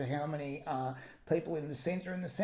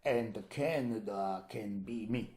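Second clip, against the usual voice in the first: lower, slow, menacing Russian strongman; menacing Putin; 7 semitones lower, slower than usual. Pretend. Co-host imagining Putin's demand, slow and low menace. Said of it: "Putin comes in and says to Trump".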